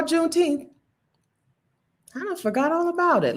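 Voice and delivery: high, wavering mock elder reminiscing; old-timer voice. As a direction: play a forgetful old-timer looking back.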